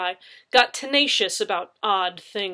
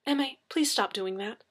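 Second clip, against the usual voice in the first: beleaguered; 5 semitones higher than usual. A narrator's reading. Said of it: "I said, beleaguered".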